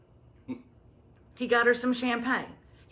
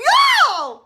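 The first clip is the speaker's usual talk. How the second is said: high, loud excited cry; excited yell; 11 dB louder than usual. Real, not acted. Host thrilled by a listener's letter, loud yell. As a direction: shout it up high with glee.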